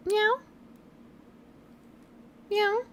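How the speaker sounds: coaxing cat meow. Real, not acted. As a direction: meow gently up at the cat, asking. Meowing at her cat again.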